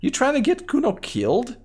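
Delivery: put-on high-pitched mouthy kid voice; Kuno voice. Kid Kuno mocks the cop, high bratty kid voice. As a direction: voice a rude little brat up high, talking about himself in third person. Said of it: "What is wrong with your high voice?".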